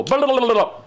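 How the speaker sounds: high, loud burst of garbled babble; mock rambling gibberish. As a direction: imitate someone gabbling it unintelligibly. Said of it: "he'll go".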